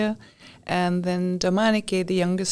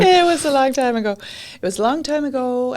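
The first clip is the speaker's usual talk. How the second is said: higher, louder and fast, the "It" hit first; insistent; 6 semitones higher, 4 dB louder than usual. Real, not acted. Guest playfully insisting to the host, emphatic. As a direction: stand firm on it, then ease off.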